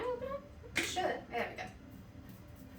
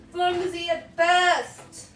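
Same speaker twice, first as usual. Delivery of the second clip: drawn out, higher and louder; slow, high, loud repeat; 5 semitones higher, 4 dB louder, much slower than usual. Shown as a demonstration.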